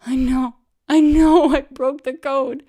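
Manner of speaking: loud, high, slow sobbing wail; high wailing sob of a caught cheater. Retold or imitated. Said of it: "Bean started sobbing again".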